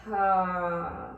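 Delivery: long audible sigh out the mouth; open-mouth sigh. Shown as a demonstration. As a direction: let a big breath go with a sigh. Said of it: "big sigh out your mouth".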